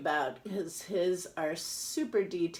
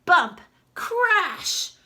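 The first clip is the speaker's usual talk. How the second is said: loud sound words; 12 semitones higher, 12 dB louder than usual. Acted. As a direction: shout the crash words out loud, Crash higher. Crate crashes in story.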